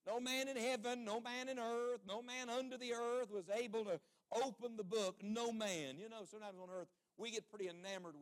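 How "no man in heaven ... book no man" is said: slow, ringing pulpit declamation; declaimed scripture. Real, not acted. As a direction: proclaim each "no man" in a sustained cry.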